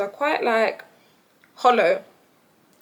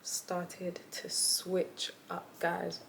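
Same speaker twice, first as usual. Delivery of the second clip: hushed whisper; whispered aside; 3 semitones lower, 15 dB quieter, much slower than usual. Real, not acted. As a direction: drop to a secret whisper.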